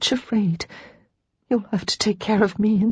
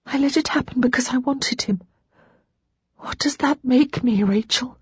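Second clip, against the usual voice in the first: wretched confession; 7 semitones higher than usual. Acted.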